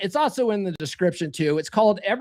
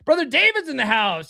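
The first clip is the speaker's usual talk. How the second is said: ordinary talk, nothing marked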